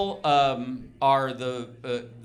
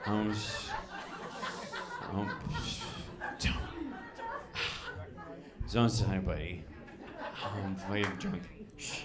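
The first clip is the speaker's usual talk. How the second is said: put-on low, mumbly slurred drunk secret; drunk whisper; 8 semitones lower, 8 dB quieter than usual. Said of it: "the worst spy in the world".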